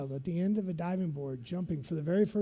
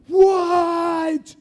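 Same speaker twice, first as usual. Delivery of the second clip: alarmed shout; 10 semitones higher, 13 dB louder than usual. Retold or imitated. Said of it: "I'm like".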